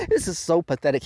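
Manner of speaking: normal talking voice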